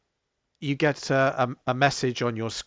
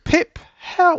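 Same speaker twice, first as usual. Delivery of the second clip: very high, loud little chime; bell-like voice; 11 dB louder than usual. Acted. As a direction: ring it out like a tiny bell. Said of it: "in a bell-like voice goes".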